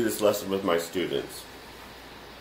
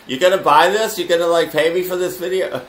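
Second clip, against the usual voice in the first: higher, louder, laughing nudge for money; mock pushy pitch; 8 semitones higher, 6 dB louder than usual. Teacher teasing viewers to buy, mock pushy. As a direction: playfully pester the viewer to buy. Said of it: "I'll say something like".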